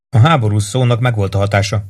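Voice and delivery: usual speaking voice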